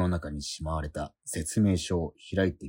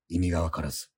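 ordinary delivery, nothing marked

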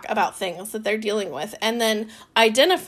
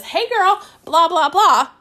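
higher, louder sing-out of a sample text; mock chummy message; 6 semitones higher, 5 dB louder than usual. Coach quoting someone's genuine message, mock chummy. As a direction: put on a girlfriend-style greeting, pitched up.

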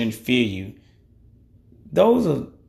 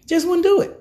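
much higher, insisting "Just wouldn't do it"; emphatic repeat. Real, not acted. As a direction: stress "wouldn't" in "Just wouldn't do it" high and firm. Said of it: "I wouldn't do it".

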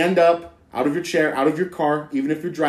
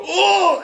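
high, loud "oh"; sudden high exaggerated cry; mock pained cry; 5 dB louder than usual. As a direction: imitate someone yelping when kicked. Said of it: "Then they go".

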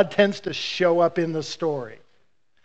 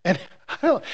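ordinary talk, nothing marked

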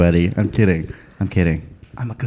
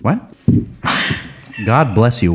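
normal talking voice

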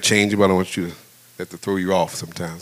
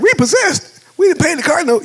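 very high, loud mock indignation; outraged disbelief; 7 dB louder than usual. Husband told the car is repossessed, hammed-up disbelief. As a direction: act out a squawking, incredulous protest.

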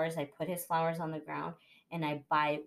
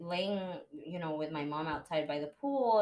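usual speaking voice

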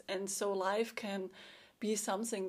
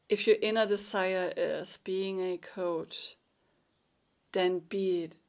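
usual speaking voice

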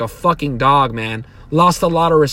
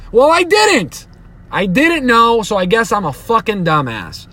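indignant outburst; 5 semitones higher than usual. Real, not acted. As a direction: snap back at the critics, voice jumping up high. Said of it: "I'm getting a little worked up".

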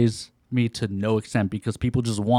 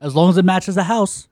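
louder, higher wife impression; wife's voice, hammed up; 6 semitones higher, 5 dB louder than usual. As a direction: play up the wife's condition. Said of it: "she was like".

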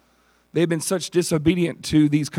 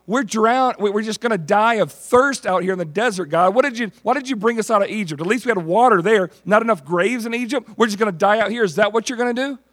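high, fast panicky whine; hammed-up grumbling crowd near death; whining Israelites; 6 semitones higher than usual. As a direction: wail at God like thirsty complainers. People complaining in the desert.

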